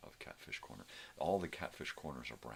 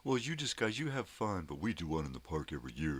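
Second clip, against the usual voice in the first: much higher, louder mock snide voice; imitating a passive-aggressive rival, high and snide; 3 semitones higher, 4 dB louder than usual. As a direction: mimic a smug know-it-all brushing you off. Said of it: "so passive-aggressive".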